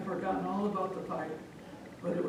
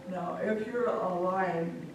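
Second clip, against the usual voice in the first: usual speaking voice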